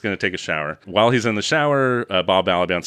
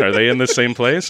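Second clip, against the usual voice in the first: ordinary delivery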